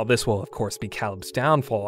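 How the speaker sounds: normal talking voice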